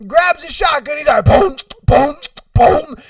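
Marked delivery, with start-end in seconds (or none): 1.2-3.0 loud, high explosive booms; imitated gunshots. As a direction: fire off punchy mouth-made shotgun blasts. Imitating the shotgun blasts.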